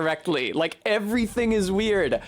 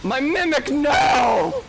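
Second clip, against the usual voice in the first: high cry of dismay building to a shout; distressed shout; 10 semitones higher, 5 dB louder, much slower than usual. Real, not acted. Gamer seeing the Mimic in trouble, distressed shout. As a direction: call out in alarm, pitch jumping up. Crying out as ally is hit.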